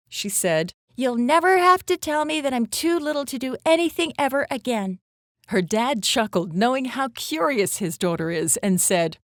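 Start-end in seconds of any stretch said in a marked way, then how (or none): 1.0-5.0 put-on higher-pitched, childlike assertion; lightly voiced little girl. Little girl reassuring her dad after her adventure, lightly childlike assurance.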